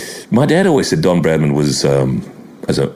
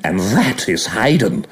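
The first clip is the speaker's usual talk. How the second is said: slow, dry commentator voice; deadpan commentary call, drawn out; Richie Benaud impression. Imitating Benaud as Hayden hits out.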